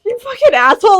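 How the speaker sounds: loud, high retold scolding; imitating an indignant friend, loudly; mock outrage. Retold outraged reply, amid laughter.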